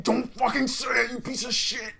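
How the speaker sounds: wife in labour snarling at her husband, loud and high; mock enraged wife. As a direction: cut him off like a woman in labour at her limit. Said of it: "and she's like".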